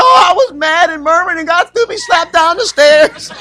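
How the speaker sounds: high, wailing laugh-cry voice; hammed-up high hysterics, stretching out the ending; imitated hysterical wife. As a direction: mimic her laughing helplessly through the confession. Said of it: "she hysterical now".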